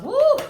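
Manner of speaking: high, loud whoop of delight; excited whoop. Real, not acted. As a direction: let out a big excited woo. Excited shout while reading cards.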